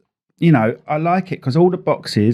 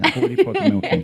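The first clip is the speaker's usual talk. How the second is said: very high, fast, laughing squeak; mock squeaky voice; 14 semitones higher, faster than usual. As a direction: squeak it back as a silly joke. Mocking echo of own complaint.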